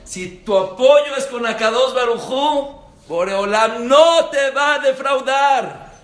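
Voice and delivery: high, loud, slowed preaching climax; fiery preaching. Acted. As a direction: hammer the promise home. Predica con fuerza. (predicación con fuerza, alta y lenta)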